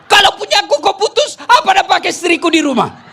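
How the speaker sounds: outraged husband, half laughing; comic outrage at the officer; indignant husband. Acted. Husband protests to PLN officer.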